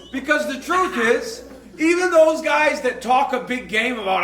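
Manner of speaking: ordinary talk, nothing marked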